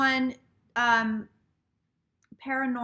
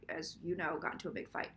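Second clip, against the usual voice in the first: whispered, much softer and lower; whispered aside; 7 semitones lower, 12 dB quieter than usual. Real, not acted. Confiding to viewers about the Stripe fight, whispered.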